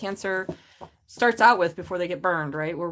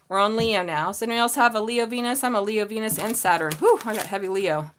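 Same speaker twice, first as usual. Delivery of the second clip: ordinary talk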